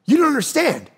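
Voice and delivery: high-pitched, amazed rush of a stunned friend; breathless disbelief, voiced friend; excited retelling. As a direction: blurt it out like you can't believe it. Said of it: "He goes".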